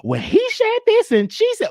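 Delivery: high mocking gossip voice. Mocking gossip he avoids, high squeaky tone. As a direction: toss off petty gossip in a high put-on voice. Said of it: "ain't about".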